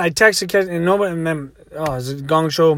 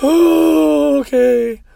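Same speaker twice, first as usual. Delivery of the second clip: high, drawn-out mock whimper; tearful giving in; 9 semitones higher, 5 dB louder than usual. Snotty crying woman agrees to driver's plan, mock whimper. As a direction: sigh it out in an exaggerated high whine. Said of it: "she's just snotting everywhere".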